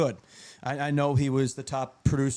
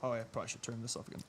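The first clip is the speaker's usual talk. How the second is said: soft, fast aside; quiet aside; 6 semitones lower, 15 dB quieter, much faster than usual. Real, not acted. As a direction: mutter it off-mic. Muting the stream audio.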